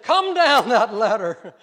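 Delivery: put-on loud, slow shout from an indignant old man; called-out order. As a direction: call up angrily to someone on a ladder. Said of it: "He called out to a man".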